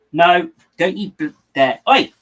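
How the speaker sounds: a sharp "oi" to stop the cat; warning the cat. Real, not acted. Cat still going at the toy.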